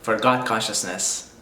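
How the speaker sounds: ordinary delivery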